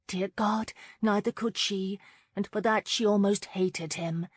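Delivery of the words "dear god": ordinary delivery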